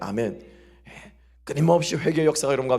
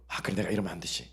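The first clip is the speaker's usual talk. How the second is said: quiet self-talk; 8 semitones lower, 10 dB quieter than usual. Retold or imitated. Inner self-correction voiced.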